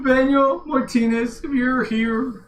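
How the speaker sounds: high, slow, pining call; mock longing. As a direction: call out wistfully for a lost friend. Answers the teasing with mock longing.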